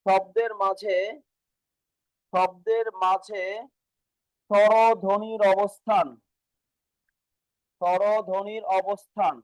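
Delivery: very slow, phrase by phrase with long pauses; slow dictation. Shown as a demonstration. Teacher dictating notes to students, very slow dictation. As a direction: draw out each word so they can write it.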